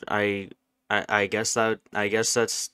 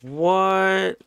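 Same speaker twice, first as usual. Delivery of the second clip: 1 startled what; 8 semitones higher than usual. Real, not acted. Startled in horror game.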